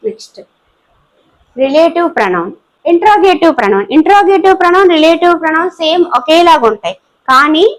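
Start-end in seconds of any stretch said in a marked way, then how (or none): none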